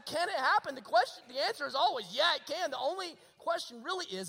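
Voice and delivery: high, loud, fervent exhortation; ringing pulpit proclamation; full-force preaching. Real, not acted. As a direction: drive it home to the congregation.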